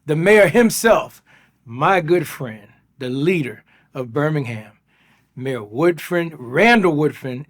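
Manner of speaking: loud, upbeat show-opening welcome; hyping up the guest like an announcer; podcast host intro. Acted. Host welcomes listeners and mayor, announcer style.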